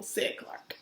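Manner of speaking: breathy whisper tag. A narrator's reading. Reading to kids, whispering the tag. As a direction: drop to a whisper.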